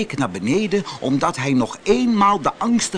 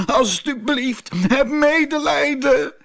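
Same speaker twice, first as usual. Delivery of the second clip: wailing plea; 12 semitones higher, 3 dB louder than usual. Acted. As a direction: wail for mercy in terror. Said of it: "huilde Abdullah". (jammerend smeken)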